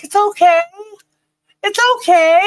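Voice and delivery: high, loud sing-song comfort; loopy reassurance. Retold or imitated. Comforting crying husband, high giddy sing-song. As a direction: soothe him, giddy and carefree. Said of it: "I was so loopy".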